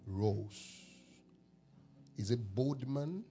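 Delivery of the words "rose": usual speaking voice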